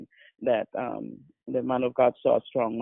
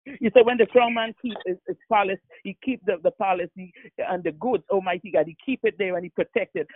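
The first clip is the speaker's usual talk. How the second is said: impassioned prayer, punching out the key phrases; forceful prayer; 4 semitones higher than usual. Real, not acted. Pastor prays against the strong man, forceful fervent prayer. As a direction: pray with aggression, building in intensity.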